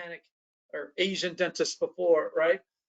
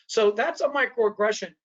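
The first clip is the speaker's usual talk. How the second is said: ordinary talk, nothing marked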